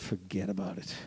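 low, soft giving-up mumble; resigned. Retold or imitated. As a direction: shrug it off and walk away. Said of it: "they all just said".

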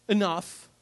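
ordinary talk

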